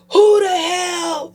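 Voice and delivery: high, loud, slow yell; outraged shout. Retold or imitated. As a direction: shout it at the whole house. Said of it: "all you say is".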